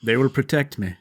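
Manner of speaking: low, gruff dad voice; a hint of a stubborn dad; sorcerer dad voice. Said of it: "That was your sorcerer dad".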